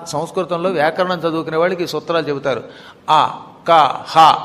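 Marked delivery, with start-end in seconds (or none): 3.1-4.3 ఆ, క, హ each sounded separately from the throat; throat sounds. Shown as a demonstration. Teacher showing listeners the throat letters, ఆ, క, హ sounded from the throat. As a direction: say ఆ, క, హ from the throat, one by one. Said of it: "ఇవి కంఠం నుంచి పలకాలి".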